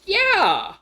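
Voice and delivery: high, loud burst of agreement; excited. Real, not acted. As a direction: jump in with a thrilled yes. Eager agreement.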